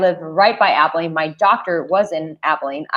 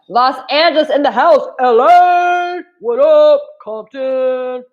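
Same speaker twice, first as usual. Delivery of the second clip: much higher, louder hype-man shout; 7 semitones higher, 5 dB louder than usual. Pretend. Greeting LA viewers with a loud hype shout.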